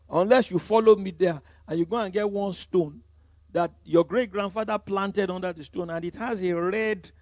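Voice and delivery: ordinary talk, nothing marked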